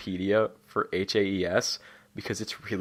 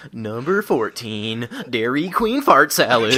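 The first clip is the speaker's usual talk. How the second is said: drawn-out parody announcer, building louder; mock countdown narrator; 4 dB louder, slower than usual. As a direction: stretch it out like a countdown host. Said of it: "it's like".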